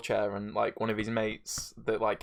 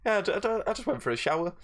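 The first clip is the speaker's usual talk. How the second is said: Andy voice; 8 semitones higher than usual. Retold or imitated. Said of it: "He's like".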